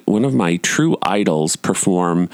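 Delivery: usual speaking voice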